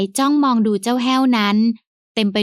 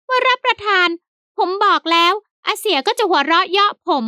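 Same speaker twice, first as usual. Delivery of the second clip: high servant voice, peeved; sulky high servant; 9 semitones higher, slower than usual. A narrator's reading. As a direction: grumble it up high like the offended servant. Servant Haew sulks, glaring.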